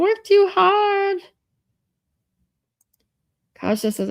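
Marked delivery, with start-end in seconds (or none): 0.0-1.2 very high, loud, stretched send-off; sing-song calling out. Real, not acted. Streamer sends a working viewer off, calling out sing-song. Calls out to viewer going to work.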